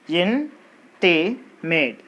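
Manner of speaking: "intimate" said very slowly in separate beats; slow syllable count. Shown as a demonstration. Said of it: "three syllables in this word".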